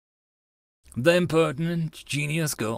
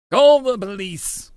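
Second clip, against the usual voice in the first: loud, high panicked call for help; a bystander shouting for the police in panic; 6 semitones higher, 9 dB louder than usual. Acted. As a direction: yell it out in panic. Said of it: "a man cries out in panic".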